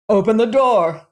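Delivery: loud, high furious shout; angry shout. A narrator's reading. Angry man outside shouting at the locked door. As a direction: bellow through the door to be let in. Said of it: "it was shouting".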